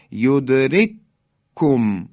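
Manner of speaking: یدرککم with the two kafs pressed into one strong k; merged k. Shown as a demonstration. Teacher showing the two kafs merging, firm doubled k.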